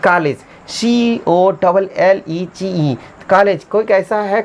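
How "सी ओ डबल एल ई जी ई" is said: slow, letter-by-letter dictation; measured dictation of each letter; spelling out letters. Shown as a demonstration. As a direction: spell it slowly for the class. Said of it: "सही उच्चारण spelling test है".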